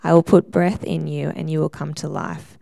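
ordinary talk

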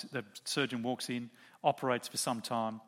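normal talking voice

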